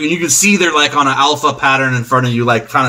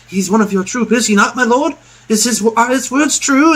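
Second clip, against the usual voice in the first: high, pleading questions; humble beggar; 8 semitones higher than usual. Acted. Beggar asking his lord about the troop, high and humble. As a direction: ask upward in a raised beggar's voice. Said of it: "four or five beggars show up".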